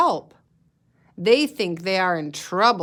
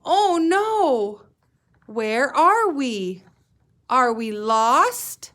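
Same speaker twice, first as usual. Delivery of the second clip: high, loud, slow alarmed seal voice; worried baby seal; 4 semitones higher, 4 dB louder, much slower than usual. Acted. Teacher and class reading, voicing frightened baby seal. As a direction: wail it like a lost baby seal.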